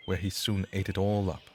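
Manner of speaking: usual speaking voice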